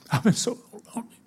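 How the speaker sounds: high, quick tearful wail; retold crying. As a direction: imitate an old man weeping from loneliness. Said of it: "did he start crying".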